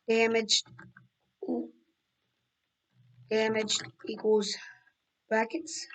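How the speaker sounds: lightheaded, dizzy and slow. Real, not acted. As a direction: talk while feeling lightheaded. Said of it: "I'm so lightheaded right now".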